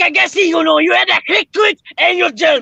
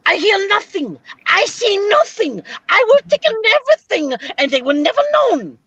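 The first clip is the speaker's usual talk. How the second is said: put-on comic German accent, slowed on the questions; mock Schultz voice. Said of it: "I used to love that show".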